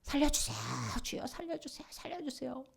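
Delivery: high-pitched, imitated cry for help; desperate plea. Acted desperate cry for rescue.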